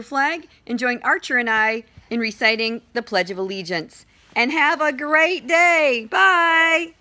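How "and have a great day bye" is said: high, drawn-out farewell call; high-pitched, held-out cheerful goodbye; cheery sign-off. Real, not acted. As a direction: sing out a big goodbye to the school. Calling out a sign-off.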